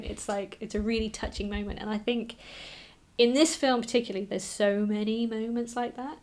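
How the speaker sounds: ordinary talk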